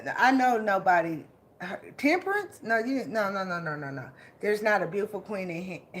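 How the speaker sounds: usual speaking voice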